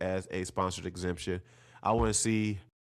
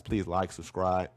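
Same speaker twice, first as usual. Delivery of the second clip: nasal, stuffed-up voice; talking through a blocked nose; slower than usual. Real, not acted. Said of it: "I sound so nasally".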